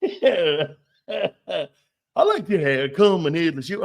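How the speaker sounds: fake Cajun accent. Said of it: "phony-ass accents".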